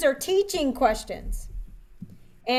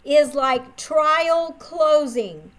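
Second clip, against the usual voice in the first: very slow, each word spaced; a trainer dictating a key rule; slow dictation; much slower than usual. Shown as a demonstration. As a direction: say it slowly so they can write. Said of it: "write this down".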